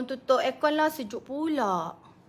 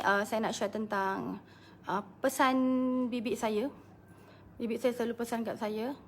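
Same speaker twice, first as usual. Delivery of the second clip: usual speaking voice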